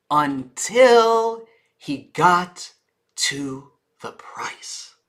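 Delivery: crawling slow, price whispered; dramatic slow-down to a whisper; very slow pace. Shown as a demonstration. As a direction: stretch every word, whisper the price. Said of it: "Notice my pace".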